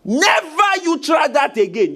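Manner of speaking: high, slow, forceful warning; stern shouted rebuke. Real, not acted. Preacher forbidding timid talk to spirits, stern shout. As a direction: scold hard, drawing out each word.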